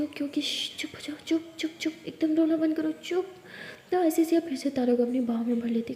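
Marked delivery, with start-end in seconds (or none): 0.0-3.3 soft, repeated shushing; quietly calm someone out of crying; near-whispered comforting; hushed soothing. Acted.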